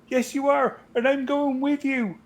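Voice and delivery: high West Country Samwise voice; Somerset accent. Retold or imitated. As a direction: sing it out in broad West Country. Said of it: "that is proper Somerset".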